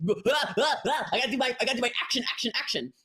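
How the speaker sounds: mock the stressed seller's grind; frantic hustle mode. Coach mocking a stressed seller stuck in hustle mode, frantic. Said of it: "if you are in this".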